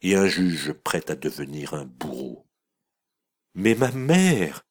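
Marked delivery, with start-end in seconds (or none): 3.5-4.6 cri aigu et lent, blessé; proteste, suppliante; cri d'innocente. A narrator's reading. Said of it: "s'écria la pauvre enfant". (la fille insultée s'écrie vers sa mère)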